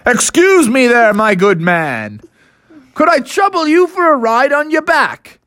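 high, hooting gentlemanly request; courteous Father Bear. Acted. Father Bear asking the triceratops for a ride, courteous. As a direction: ask with exaggerated politeness.

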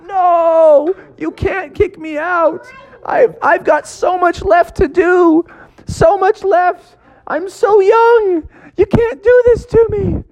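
very high, slow begging frog voice; desperate frog. Acted. Froggy begs not to leave.

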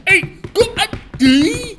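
high, slow mock Yorkshire; Yorkshire farmer offering a brew, hammed up; Yorkshire accent. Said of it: "back into the Yorkshire accent".

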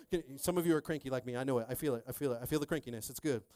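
normal talking voice

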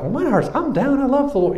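sarcastic high-pitched excuse; mock protest. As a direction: mimic a churchgoer insisting they're fine. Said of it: "And we're like".

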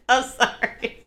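laughing helplessly while apologizing; sorry, through laughter that hurts; laughing through pain. Real, not acted. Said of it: "It hurts to laugh".

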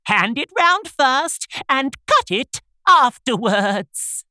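slow, high, put-on instruction; haughty unicorn. A narrator's reading. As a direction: spell out an order in a lofty high voice.

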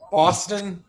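put-on a half-hearted go at a Boston accent; attempted Boston accent. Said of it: "I'm used to my Southern accent".

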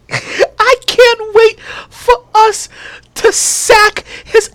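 high, drawn-out gloating with joy; almost crying with happiness; gleeful, near tears. Real, not acted. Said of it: "I'm almost in tears".

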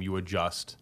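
ordinary talk

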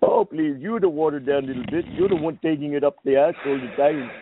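ordinary delivery

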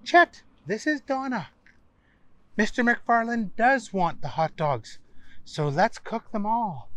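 high, polite hostess voice; Donna's voice. Acted. Donna calls Chet on watch.

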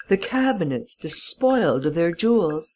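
ordinary delivery, nothing marked

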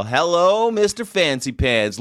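high, loud teasing hello; mocking greeting. Host teasing Louis the fourteenth, mocking greeting. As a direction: greet him with exaggerated mock deference. Mock greeting to the Sun King.

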